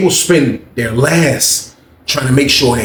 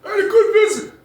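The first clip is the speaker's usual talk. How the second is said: high, soft lip-read line; high quiet imitation of Jeff; mimicking Jeff; 3 dB quieter than usual. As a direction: play the guy mouthing from afar. Voicing Jeff's lip-read words.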